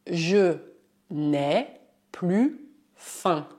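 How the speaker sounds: "je n'ai plus faim" broken into slow separate chunks; teacher spelling out "je n'ai plus faim" slowly; slow dictation. Shown as a demonstration. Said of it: "Um outro exemplo".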